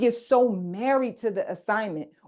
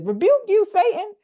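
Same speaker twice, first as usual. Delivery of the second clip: higher, louder retold rebuke; stern rebuke; 14 semitones higher, 6 dB louder than usual. Jesus turning on Peter, loud high rebuke. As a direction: snap back hard, pitched way up. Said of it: "that's when Jesus says".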